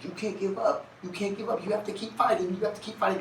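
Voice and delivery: high, pleading encouragement; wife urging him on. Retold or imitated. Wife urging her husband not to give up, high and pleading. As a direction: voice her begging him to keep fighting. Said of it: "And she'd be like".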